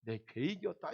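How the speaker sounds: a hint of a solemn God; God's voice. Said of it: "하나님이 말씀하시기를".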